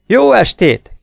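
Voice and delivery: loud, high-pitched hail; hailing loudly with grim humour; gallows-humour shout. A narrator's reading. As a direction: call out a mock-cheery greeting. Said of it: "kiáltott fel hozzájuk némi akasztófa humorral".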